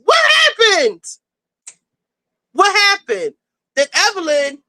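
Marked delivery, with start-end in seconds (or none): 0.0-3.3 exasperated shout. Retold or imitated. Recapping the show to viewers, shouting in disbelief. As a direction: yell it in outraged disbelief. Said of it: "And I'm like".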